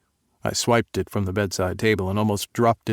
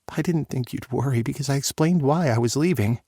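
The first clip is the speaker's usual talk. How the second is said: daughter voice; 5 semitones higher than usual. Acted.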